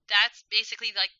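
loud, emphatic mock disbelief; excited protest. Granddaughter playfully contradicting her grandmother, excited protest. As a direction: retell it as a loud, excited objection. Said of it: "I was so excited".

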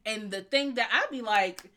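ordinary delivery

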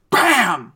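loud imitated bam of a crash; crash sound. Retelling the crash, a loud mock bam. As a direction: slam out the impact noise.

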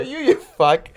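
very high mock retort; hammed-up indignant comeback; retold insult. Repeats his retold comeback.

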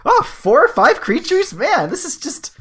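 high, loud astonished rereading; stunned, checking the card again; reading in disbelief. Retold or imitated. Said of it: "I was like".

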